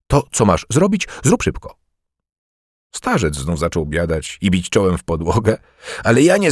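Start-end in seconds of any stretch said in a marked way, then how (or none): 0.1-1.8 impatient, pitched higher, curt; irritated king, pitched higher. A narrator's reading. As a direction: snap it out like an annoyed king. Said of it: "rzekł w końcu z rozdrażnieniem".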